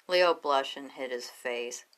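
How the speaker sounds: plain reading voice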